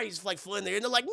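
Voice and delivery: ordinary delivery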